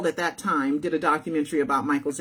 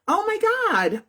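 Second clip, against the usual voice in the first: mock surprise; 14 semitones higher than usual. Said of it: "And I'm like".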